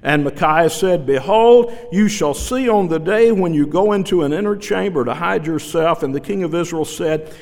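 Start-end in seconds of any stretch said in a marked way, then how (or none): 1.0-6.0 loud, proclaiming rebuke; forceful prophecy. Acted. Micaiah rebuking Zedekiah, loud and stern. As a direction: thunder it at the man who struck him.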